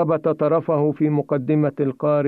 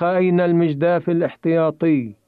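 higher-pitched cry, moderately projected; mild narrated shout; 6 semitones higher than usual. A narrator's reading. Samir asks Nader for the spare paddle, mild shout. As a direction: call it out with restrained urgency. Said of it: "فصرخ".

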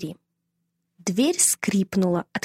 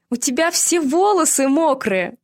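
laughing; 6 semitones higher than usual. A narrator's reading. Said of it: "громко рассмеялась".

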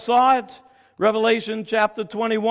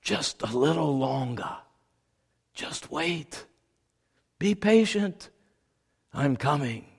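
lower, soft, reassuring; gentle divine voice; 6 semitones lower, 6 dB quieter than usual. Acted. God answers the waiting martyrs, soft reassuring voice. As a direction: comfort them quietly. They heard a voice.

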